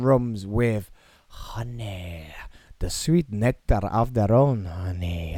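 put-on slow, drawn-out Scottish accent. Podcaster plugging honey-infused whiskey, in a put-on Scottish accent. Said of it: "talking in Scottish".

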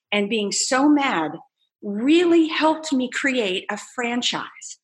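normal talking voice

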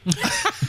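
sudden high-pitched giggle; cracking up mid-bit; high burst of laughter. Real, not acted. Caller breaks into laughter.

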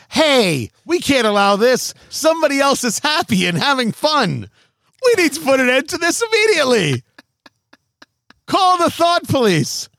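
whiny scold building to shrill; outraged social media voice. Retold or imitated. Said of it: "I am full of the impressions today".

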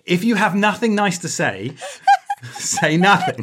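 high, loud scolding broken by laughter; mock reproach through giggles; laughing telling-off. Real, not acted. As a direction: scold them while cracking up.

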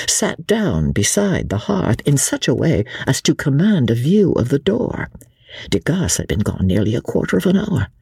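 ordinary delivery, nothing marked

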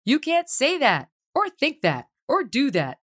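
high, slow announcer title; announcing a segment. Acted. Host introducing recurring segment to listeners, high announcer voice. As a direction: present the segment name like a show host. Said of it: "a feature we call".

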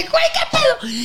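high squeaky alarm; startled. Retold or imitated. Girl waking to her mother's cry, startled high squeak. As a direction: freak out, voice jumping up.

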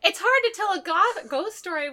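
high-pitched, amused voice on the edge of laughing; fighting laughter. Real, not acted. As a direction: try to talk through the giggles. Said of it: "when you're laughing".

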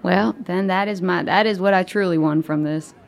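ordinary talk, nothing marked

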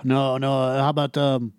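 put-on a light French accent as a beach hawker; hawking jet skis in a hint of French. Beach vendor pitching tourists, lightly French accented. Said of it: "the French guy in the Mexican beach impression".

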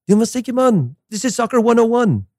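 mock French coach accent, pitched high; imitating a French coach yelling from the sidelines; lightly French-accented coach shouting. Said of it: "in his French accent".

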